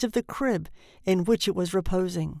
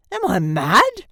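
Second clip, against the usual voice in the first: loud, outraged question; thundering; 8 dB louder than usual. A narrator's reading. Shocked father to the nurse, thundering. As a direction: thunder it, terror turning to rage. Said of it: "thundered Mister Button".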